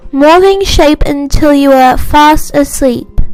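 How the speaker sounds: usual speaking voice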